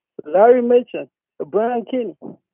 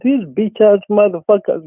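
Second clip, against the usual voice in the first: normal talking voice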